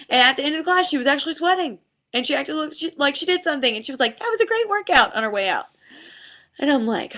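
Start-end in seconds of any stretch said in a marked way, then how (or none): 4.2-5.1 higher, chirpy self-satisfied remark; chirpy student. Retold or imitated. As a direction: toss it off pleased with herself. Student pleased with herself leaving.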